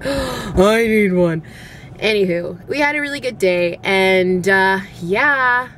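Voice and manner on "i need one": slow, breathy longing; craving margarita. Retold or imitated. Mom eyeing the neighbors' margarita, slow and longing. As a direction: drag it out like you're dying for a drink.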